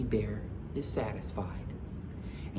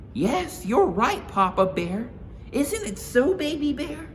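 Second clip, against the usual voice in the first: high, louder motherly bear; Mama Bear voice; 6 dB louder than usual. Acted. Mama Bear agreeing with Papa Bear, high and loud.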